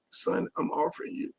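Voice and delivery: whispered quote. Retold or imitated. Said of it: "This motherfucker say".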